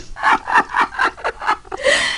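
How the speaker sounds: deep, drawn-out evil laugh; villain laugh. Acted. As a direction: laugh low like a gloating villain. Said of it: "I'm gonna do an evil laugh at you".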